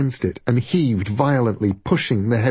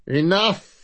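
sudden high angry shout; 9 semitones higher than usual. Acted. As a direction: snap it out, furious. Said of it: "came the voice from beyond the door".

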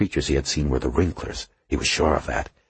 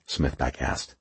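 ordinary delivery

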